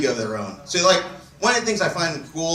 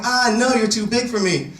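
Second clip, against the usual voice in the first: higher-pitched mock protest; imitating a partner; 8 semitones higher than usual. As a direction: play her protesting in a higher voice. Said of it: "she was all like".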